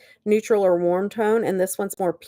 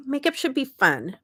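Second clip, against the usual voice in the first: ordinary talk, nothing marked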